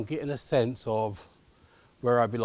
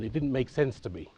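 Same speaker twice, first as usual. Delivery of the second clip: usual speaking voice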